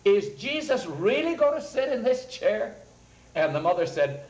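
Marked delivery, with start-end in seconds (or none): 0.0-2.8 put-on high, wondering child's voice; little girl. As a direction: ask it like a small girl who can't believe it. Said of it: "Alice, said".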